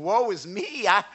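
high, strained confession of unworthiness; high, anguished woe is me; humbled prophet. Retold or imitated. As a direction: cry it out, overwhelmed. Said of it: "he fell down saying".

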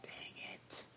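muttered whispered frustration; whispered dang it. Retold or imitated. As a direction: whisper it like a private curse. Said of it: "I thought".

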